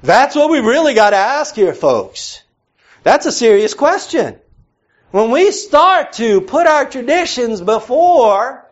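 high, loud, fervent preaching of Paul's words; preaching at full force. Acted. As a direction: drive the scripture home, pulpit-strong.

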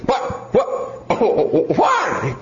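high, sputtering disbelief; put-on child, flustered; protesting kid. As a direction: whine at an unexpected punishment. Child protests announced spanking.

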